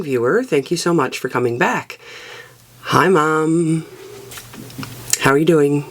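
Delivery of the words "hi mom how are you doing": usual speaking voice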